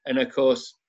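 ordinary talk

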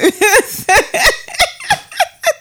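high-pitched burst of laughter. Real, not acted. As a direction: crack up at your own joke. Laughs at own joke.

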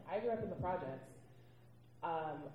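ordinary talk

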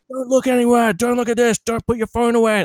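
high, insistent mock shouting; imitating barked orders. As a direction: fire off orders like airport security. Imitating security staff barking orders.

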